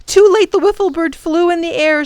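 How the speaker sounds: ordinary delivery, nothing marked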